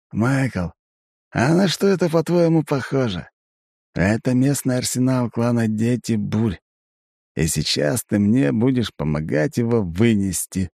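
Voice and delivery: high, scheming elf voice; a grinning elf with a plan; Aiven. A narrator's reading. Elf explains the arsenal.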